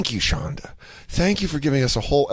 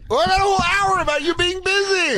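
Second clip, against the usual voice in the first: mock excited pitch. Mocking pitch of a bit.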